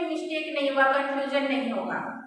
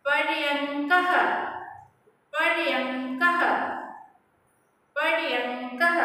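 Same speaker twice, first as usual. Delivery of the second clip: slow model word; slower than usual. Shown as a demonstration. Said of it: "you pronounce with me".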